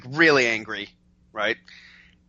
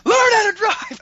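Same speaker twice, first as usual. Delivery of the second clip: very high, loud mock scream; imitated determined yell; 7 dB louder than usual. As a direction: scream it as the insistent angry driver. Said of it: "she said it again".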